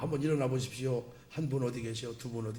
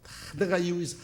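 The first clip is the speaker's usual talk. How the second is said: ordinary talk, nothing marked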